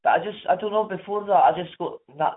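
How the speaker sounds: ordinary talk, nothing marked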